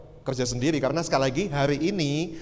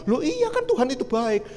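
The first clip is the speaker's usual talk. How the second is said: much higher, insistent comeback; retold earnest protest; 10 semitones higher than usual. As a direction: push back as if it were obvious. Acted man insists in reply.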